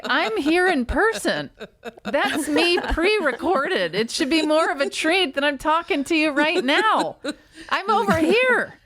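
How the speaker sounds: high laughing protest, near tears; indignant self. Retold or imitated. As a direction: whine it, laughing, hurt and high. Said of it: "And I was like".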